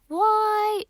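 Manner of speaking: high, loud, stubborn why; questioning child. Acted. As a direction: push back with a question. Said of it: "Ada questioned".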